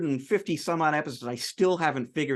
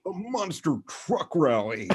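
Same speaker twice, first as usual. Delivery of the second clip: slow hammed-up rally announcer; monster truck ad; 4 semitones higher, slower than usual. Co-host obliging with a mock rally ad, slow and hammed-up. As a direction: drag it out like a truck rally promo. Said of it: "say something like".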